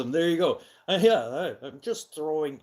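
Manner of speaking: loud, high emphatic burst; throwing emphasis. Real, not acted. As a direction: punch it out for emphasis. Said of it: "I'm not yelling, I'm throwing emphasis".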